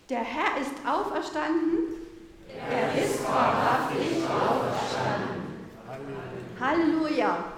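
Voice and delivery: slow, full-voiced call of faith; loud, slow and jubilant: "Er ist wahrhaftig auferstanden"; loud Easter proclamation. Real, not acted. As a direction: proclaim it wholeheartedly and slowly. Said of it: "nochmal von ganzem Herzen laut sagen".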